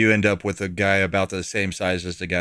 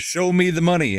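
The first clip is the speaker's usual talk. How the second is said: quoting cop; 10 semitones higher, 3 dB louder than usual. Retold or imitated. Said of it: "one of the cops just goes".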